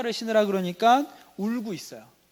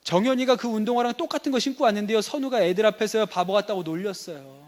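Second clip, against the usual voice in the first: higher, louder acted child's grievance; complaining child; 4 semitones higher, 5 dB louder than usual. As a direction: play a kid telling dad about being teased. Child complains of being teased. (높은 목소리로 일러바치는 아이 연기)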